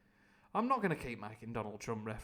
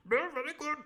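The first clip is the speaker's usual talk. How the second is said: much higher pompous gent; the Squire; 13 semitones higher, 5 dB louder than usual. Acted. The Squire approving Long John, pompous and high. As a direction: play a pompous old squire. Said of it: "That's the Squire".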